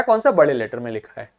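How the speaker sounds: ordinary talk, nothing marked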